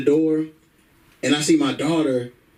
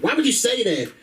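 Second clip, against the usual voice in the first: higher-pitched, offended girlfriend impression; lightly mocked woman's voice, pitched up; 6 semitones higher than usual. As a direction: protest as an indignant girlfriend.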